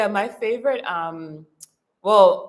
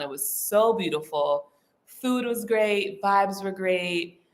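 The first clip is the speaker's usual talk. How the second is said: ordinary delivery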